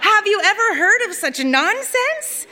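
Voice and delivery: high, scandalized scold; scolding disbelief. Acted. Kanjit to Saba, mocking Lula with high scandalized scorn. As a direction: scoff at the silly plan, pitch soaring.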